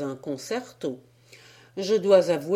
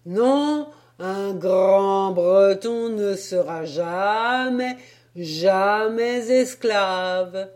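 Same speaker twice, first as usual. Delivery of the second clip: patriotic shout; 5 dB louder, much slower than usual. Acted. Sailor shouting his song near the pound, slow loud cry. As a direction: bellow it like a patriotic oath. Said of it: "criait-il à tue-tête".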